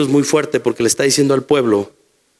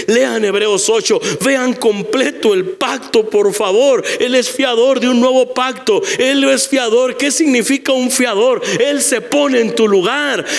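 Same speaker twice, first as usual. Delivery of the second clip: raised, high, impassioned preacher's voice; climbing pulpit fervor; fervent preaching; 7 semitones higher than usual. Acted. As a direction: preach it full force to the congregation. Pastor preaching at full force.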